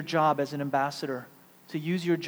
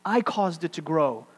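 ordinary talk, nothing marked

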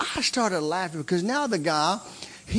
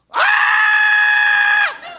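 long, high, loud "ahh" scream; imitated cry; 9 dB louder than usual. Preacher showing how the man cried out, imitated yell. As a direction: mimic a man hit by power with a drawn-out yell. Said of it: "he does like this".

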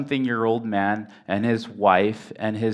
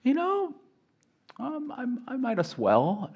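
put-on old father's shrug; 14 semitones higher, 4 dB quieter than usual. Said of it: "and he said".

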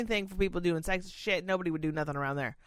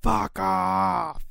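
mock snap; 4 dB louder than usual. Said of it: "That's what I wanna say".

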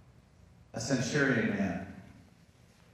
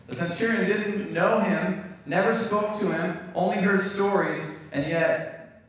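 higher, louder emphatic preaching; rising preaching fervor; 8 semitones higher, 4 dB louder, slower than usual. Real, not acted. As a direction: drive the point home with fervor. Preaching point builds in force.

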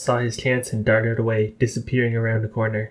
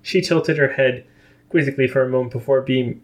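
ordinary delivery, nothing marked

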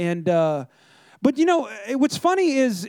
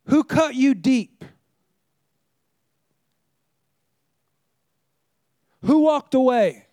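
searching preacher questions. Acted. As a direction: let each question land and sink in. Preacher asks a run of searching questions.

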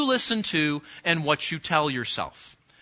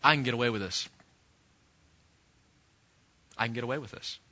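low, hushed self-talk; 8 semitones lower, 7 dB quieter, faster than usual. Acted. Sinner persuading himself to sin, low quiet self-talk. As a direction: say it softly and low, as if to yourself. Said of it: "what you have to tell yourself".

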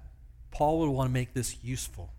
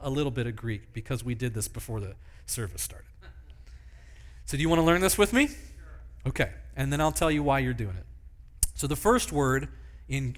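ordinary talk

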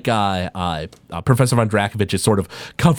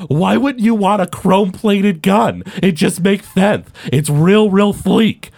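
high, slow, insistent tinkerer voice; indignant high-voiced inventor; argumentative Albus character; 8 semitones higher, 3 dB louder, slower than usual. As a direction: make the case in a pitched-up character voice.

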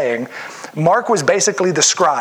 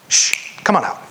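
breathy, offhand shush; casual whispered command; nonchalant Jesus. Retold or imitated. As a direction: wave it away, totally unbothered. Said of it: "I love how nonchalant Jesus is".

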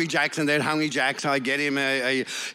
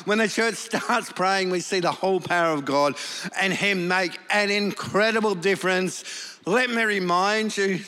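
raised, fervent preaching voice; 7 semitones higher than usual. Acted. Pastor preaching to the church with fervour. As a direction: preach it out with conviction.

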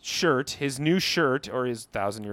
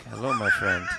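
lower, badly faked Swedish accent; terrible Swedish accent; 5 semitones lower, 3 dB louder than usual. Tiny Swedish man greets his finder, low, badly faked Swedish accent. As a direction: lightly attempt a little Swedish man. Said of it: "It's the worst Swedish accent I've ever heard".

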